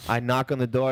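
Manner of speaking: usual speaking voice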